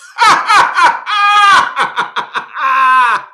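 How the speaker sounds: loud, high cackling laugh; hollering laugh. Retold or imitated. Reliving someone's fall in slides, hollering with laughter. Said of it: "When I tell you I hollered".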